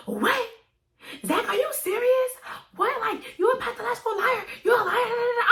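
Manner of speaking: mock outraged yell, voice cranked way up; imitating her mad. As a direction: play the furious girlfriend catching him lying. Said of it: "I guess she got mad".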